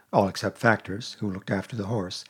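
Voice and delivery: ordinary delivery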